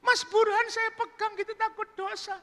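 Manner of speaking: high falsetto mock weeping; crying woman. Woman crying over her sins, mock weeping. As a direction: wail repentantly in a high falsetto. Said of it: "wanita pelacur itu menangis".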